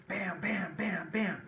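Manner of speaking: high mock drum hits; imitating snare drums. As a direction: beat out the snare like a drumline. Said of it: "and snare drums".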